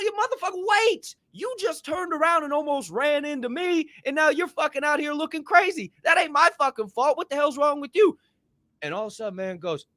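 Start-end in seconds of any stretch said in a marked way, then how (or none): 0.0-8.2 high-pitched, exasperated re-enacted argument; indignant retort. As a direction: argue back in a high, outraged voice. Acted quarrel, arguing back.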